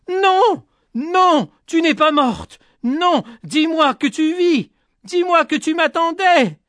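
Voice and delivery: high, anguished cries of denial; desperate screaming. A narrator's reading. Boy refusing to believe her dead, desperate screaming. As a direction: plead at the top of the voice. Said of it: "hurlant sa peur".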